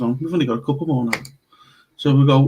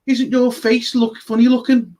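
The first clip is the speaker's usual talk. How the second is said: put-on child's blunt remark; 8 semitones higher than usual. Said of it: "he just turned round and he just went".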